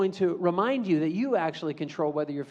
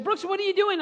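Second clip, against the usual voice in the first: sharp high call of a teacher; 13 semitones higher than usual. Retold or imitated. Teacher calls out to Brooks in sharp surprise. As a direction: catch a kid misbehaving.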